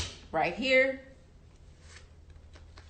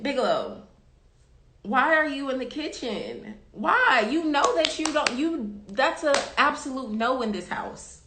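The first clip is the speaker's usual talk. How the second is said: scolding the dog; 6 semitones higher, 3 dB louder than usual. Real, not acted.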